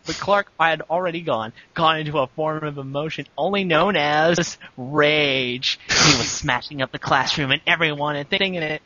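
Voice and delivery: usual speaking voice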